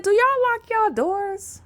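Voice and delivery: high-pitched, drawn-out asking; disbelieving high-voiced question; incredulous question. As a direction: ask it slowly, way up high. Quotes own incredulous question.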